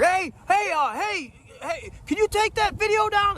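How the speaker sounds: flag someone down, raising the pitch; imitating a neighbor hailing from a distance; calling out.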